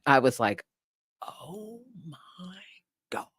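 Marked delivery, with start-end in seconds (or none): 1.2-3.3 hushed, drawn-out disbelief; stunned whisper. Retold or imitated. Neighbor reacting to the woman's offer, stunned whisper.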